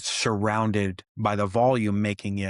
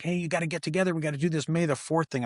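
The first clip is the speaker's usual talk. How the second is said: higher, lightly voiced pushy group; imitating eager fans; 6 semitones higher, faster than usual. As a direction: mimic the club nagging him. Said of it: "five oh first is like".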